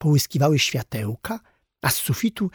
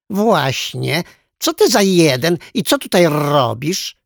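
louder, higher parrot echo; 4 semitones higher, 6 dB louder than usual. A narrator's reading. Parrot chiming in after the orangutan, echoing squawk. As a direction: repeat the question loudly like a parrot. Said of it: "zawtórowała papuga".

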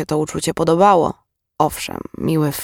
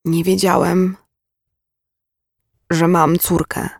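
halting, stunned admission with a long break; forced through teeth. A narrator's reading. Pale man finding his voice learns of daughter, tense halting words. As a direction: squeeze the words out tensely, pausing midway. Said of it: "wycedził".

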